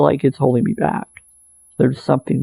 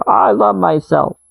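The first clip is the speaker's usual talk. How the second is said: slow, higher and earnest; self-love affirmation; 6 semitones higher than usual. Shown as a demonstration. Coaching listeners, saying a slow heartfelt affirmation. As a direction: say it slowly like you mean it.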